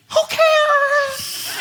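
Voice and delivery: very high mock wail; comic, drawn-out cry of frustration; exasperated. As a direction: squeal it in hammed-up exasperation. Exasperated pastor's exaggerated inward cry.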